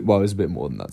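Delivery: normal talking voice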